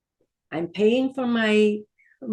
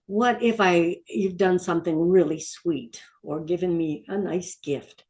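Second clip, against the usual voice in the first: ordinary talk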